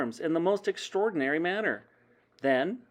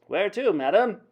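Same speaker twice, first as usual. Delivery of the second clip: raised, louder character voice; taxi driver; 6 semitones higher, 6 dB louder than usual. A narrator's reading. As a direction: ask like a brisk cabbie. Taxi driver addresses customer.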